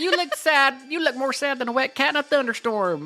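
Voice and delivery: mock Southern twang. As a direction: overplay a corny country saying. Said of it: "Like, weird, sayings".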